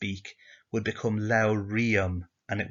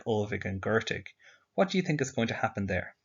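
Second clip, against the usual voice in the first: ordinary delivery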